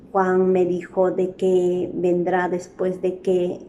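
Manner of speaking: slow and deliberate demo line; slow read of the dequeísmo example. Shown as a demonstration. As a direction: lay out each que slowly. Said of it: "cada momento repetimos ese que".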